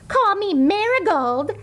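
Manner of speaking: put-on high, eager girl voice; eager schoolgirl. A narrator's reading. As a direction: ask to be a flower too. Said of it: "said Jo".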